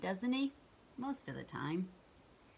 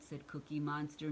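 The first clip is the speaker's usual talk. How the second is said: ordinary delivery, nothing marked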